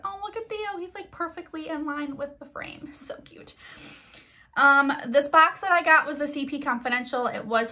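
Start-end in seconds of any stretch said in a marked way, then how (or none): 0.0-3.5 hushed, high whisper. Real, not acted. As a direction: whisper so the pet stays put. Softly pointing out pet on camera.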